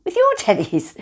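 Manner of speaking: breaking into a laugh on teddies; a real little laugh while saying it. Real, not acted. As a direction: giggle through the word. Laughs mid-word.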